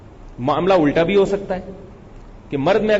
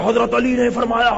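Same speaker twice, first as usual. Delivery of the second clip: high, forceful mock-cleric declaiming; strained high-pitched pulpit shout, imitated; thunderous preacher imitation; 7 semitones higher than usual. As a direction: belt it out like an overzealous preacher. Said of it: "اتنا زور تھے کہ ان کو کھانسی آئی".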